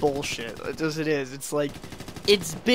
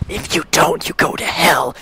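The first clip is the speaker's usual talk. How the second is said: louder, joking hellfire warning; mock preacher threat; 7 dB louder than usual. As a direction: fake sermon threat, half laughing. Jokey threat to viewers.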